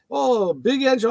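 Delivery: put-on slow, high light Jamaican accent; drawn-out Jamaican mailman voice; lightly imitated Jamaican, slow. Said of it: "So he got this Jamaican accent".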